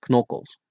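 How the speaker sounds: knokkels with the opening K clearly sounded, k-nokkels; pronounce the K. Shown as a demonstration. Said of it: "Make sure you pronounce the K".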